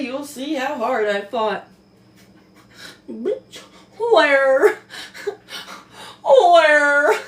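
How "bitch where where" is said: loud rising disbelief; real shocked yell; shocked outcry. Real, not acted. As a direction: cry out in outrage at the page. Shocked reading dedication, crying out.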